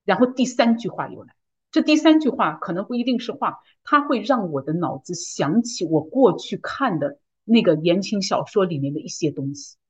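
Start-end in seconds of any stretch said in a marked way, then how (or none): none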